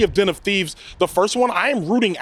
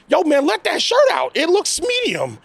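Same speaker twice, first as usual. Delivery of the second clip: exasperated plea; 9 semitones higher than usual. Acted. Reviewer begging actors to loosen tight shirts, exasperated. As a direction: plead with them in comic disbelief.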